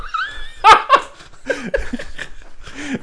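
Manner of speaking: high bursting laugh; cracking up at the story. Real, not acted.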